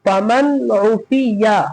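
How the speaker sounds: "फमन उफिया" recited very slowly, vowels drawn out; slow model reading of "फमन उफिया"; matan recitation. Shown as a demonstration. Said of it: "मतन पढ़ेंगे".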